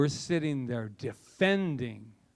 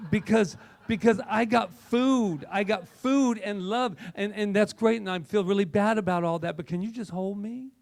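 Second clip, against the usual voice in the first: high, loud whiny plea from the cat; pleading cat voice; 5 semitones higher, 3 dB louder than usual. Pretend. Cat pleading with its owner for a cuddle, high and whiny. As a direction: beg to be held like a needy pet. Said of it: "he's like".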